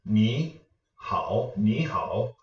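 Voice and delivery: "Ni" rising into a dipping "hao"; third changed to second. Shown as a demonstration. Teacher showing viewers the special tone change, rising then dipping. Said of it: "to a second tone".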